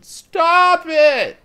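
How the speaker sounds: put-on high, loud shrieking little boy; big brother screaming at his little sister; kid yelling. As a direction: yell it like a fed-up five-year-old. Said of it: "I heard just yelling from the kitchen".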